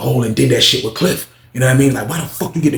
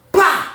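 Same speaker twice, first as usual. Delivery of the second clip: high, sharp smack noise; mock punch sound. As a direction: spit out the slap sound.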